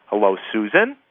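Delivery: low start, "Susan" rising into a question; questioning greeting. Shown as a demonstration. Calling her like a best friend, questioning greeting. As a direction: ask "Susan" as if checking it's a friend. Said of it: "like a question".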